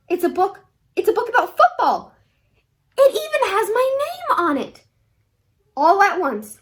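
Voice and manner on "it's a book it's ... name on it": high, mounting excitement; thrilled kid, rising in pitch; excited discovery. A narrator's reading. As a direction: build higher and higher with delight. Boy pulls football book from hat.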